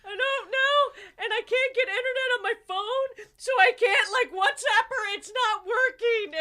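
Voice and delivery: high, teary and laughing; imitating crying. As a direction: whimper it like you're in tears, half laughing. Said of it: "I'm like".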